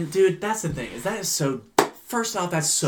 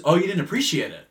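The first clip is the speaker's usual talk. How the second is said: high, incredulous taunt building louder; mocking comeback; 5 semitones higher, 11 dB louder, faster than usual. Customer mocks bartender's objection, high escalating taunt. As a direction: throw the words back mockingly, escalating.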